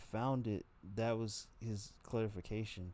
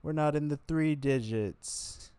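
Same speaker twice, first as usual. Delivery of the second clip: "three" drawn out higher and louder; slow emphatic stress; 7 semitones higher, 6 dB louder, slower than usual. Real, not acted. Comic stresses "three" to admit how many times.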